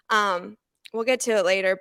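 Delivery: ordinary talk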